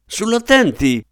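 put-on an old man straining to boom but cracking into falsetto; shaky falsetto command. A narrator's reading. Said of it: "con malferma voce in falsetto". (falsetto tremante che cerca di tuonare)

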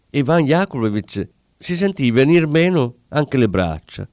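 ordinary delivery

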